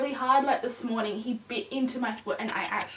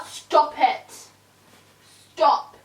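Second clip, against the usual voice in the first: firm scolding; 3 semitones higher, 6 dB louder, much slower than usual. Real, not acted. Scolding puppy.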